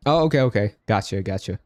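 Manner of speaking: ordinary delivery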